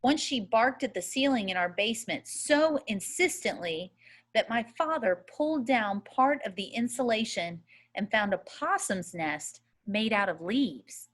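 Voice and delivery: ordinary delivery, nothing marked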